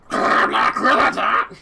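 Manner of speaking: high, loud, slow bug screech; cockroach voice. Acted. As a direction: shriek it like a giant cockroach. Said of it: "I like my cockroach voice".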